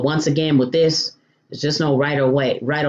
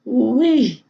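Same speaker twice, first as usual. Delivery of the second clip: high-pitched, drawn-out ooh-wee; delighted exclamation; 10 semitones higher than usual. Real, not acted. Hungry cook reacting to how good it smells, delighted high exclamation. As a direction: sing out delight at the food.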